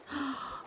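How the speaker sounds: sharp crying gasp; tearful gasp. Real, not acted. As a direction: catch the breath mid-sob. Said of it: "I hate my crying voice".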